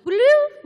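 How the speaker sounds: very high, loud electronic bloop; imitated machine bloop. Xbox turning on, loud high bloop. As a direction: pop it out like a startup chime. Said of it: "And then".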